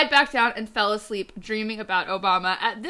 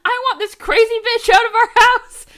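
high, loud outburst; furious demand; 11 semitones higher, 9 dB louder than usual. A narrator's reading. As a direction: shout it in hammed-up exasperation. Furious demand voiced in the story.

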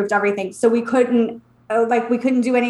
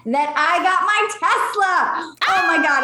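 announcing big news; 6 semitones higher than usual. Real, not acted. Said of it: "so I have some exciting news".

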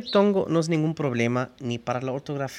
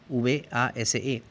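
ordinary talk

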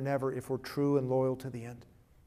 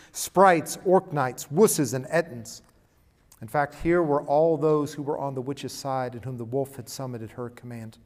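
lively read-aloud with swells of volume; story reading; 7 dB louder than usual. Acted. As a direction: read it to the children like a vivid storybook. Reads Narnia passage dramatically.

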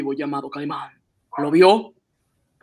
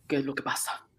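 frightened whisper; 2 semitones lower, 14 dB quieter, faster than usual. Acted. Inspector spooked by the laugh.

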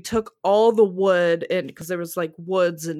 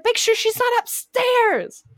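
much higher, drawn-out frantic yell; high, slow panicked shout; slower than usual. Retold or imitated. Wife yelling orders while searching for the cat. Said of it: "I screamed so loud".

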